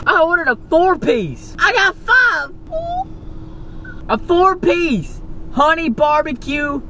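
high, thrilled shout of joy; over the moon about it; excited. Real, not acted. Excited about five chicken pieces.

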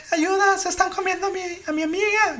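high, frantic plea; panicked caller. Retold or imitated. Caller telling the operator her friend is attacked, panicked. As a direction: imitate the terrified caller. Retold frantic 911 call.

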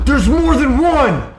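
loud, high alarmed yell; alarmed shout. Real, not acted. Seeing more aliens.

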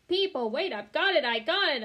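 loud, high eureka call; excited. A narrator's reading. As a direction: burst out with the idea. Sudden bright idea.

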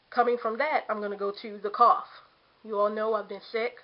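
normal talking voice

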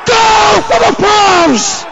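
high, drawn-out goal roar; long goal yell. Real, not acted. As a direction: scream the goal for the Pals. Late goal celebration.

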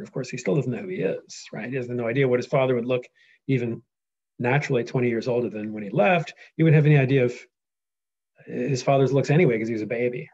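ordinary talk

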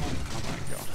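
hushed, breathless mock dread; mock whisper. Hushed, mock scared reaction to the ghost story.

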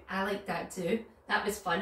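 plain reading voice

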